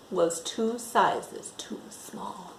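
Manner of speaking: hushed, slow dramatic whisper. A narrator's reading. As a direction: drop to a slow secret whisper.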